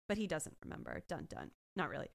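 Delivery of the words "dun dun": low, deep mock dramatic sting; dun dun sting. Recapping the episode, a low mock sting. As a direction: drop the voice for a TV sting.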